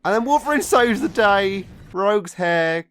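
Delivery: high, loud sarcastic announcement; mock triumphant. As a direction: hype it up ironically. Mock triumphant plot summary.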